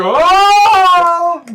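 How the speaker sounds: high, loud crowd exclamation; a whole tavern gasping at once. Acted. GM voicing onlookers gasping at the chug. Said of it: "everyone's like".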